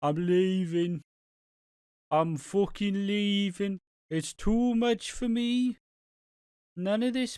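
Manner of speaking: slow, rising into a high voice; hammed-up, drawn-out high-pitched announcement; mock dramatic quote. Voicing a text message, drawn out.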